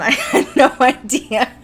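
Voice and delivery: loud, slow words through laughter; helpless laughing. Real, not acted. Host admitting to Elfie the unnoticed tear, helpless laughing.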